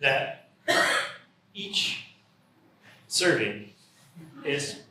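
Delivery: one word per speaker, halting; halting word-by-word delivery; one word at a time. Pretend. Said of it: "They can only speak one word at a time".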